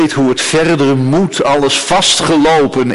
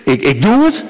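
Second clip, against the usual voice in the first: raised pitch, insistent avowal; emphatic declaration; 7 semitones higher than usual. Acted. God avows lasting love to Israel, emphatic and insistent.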